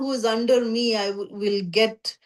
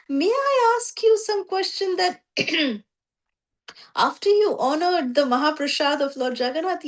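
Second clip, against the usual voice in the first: raised, hesitant voice of a shy devotee asking a question; timid devotee asking; 7 semitones higher than usual. Retold or imitated. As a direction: ask shyly, higher, as the hesitant devotee.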